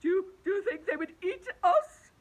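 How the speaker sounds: high, loud, stammering fright; scared earthworm. Acted. Earthworm asking if they'd be eaten, high frightened stammer. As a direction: stumble over the words in terror. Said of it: "the earthworm asked".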